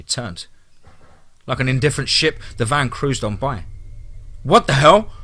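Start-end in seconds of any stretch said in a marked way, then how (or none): 4.4-5.1 higher, louder cry of sudden panic; panicked cry. Acted. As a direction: blurt it out in shock and panic. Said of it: "Brady cried, unusual panic in his voice".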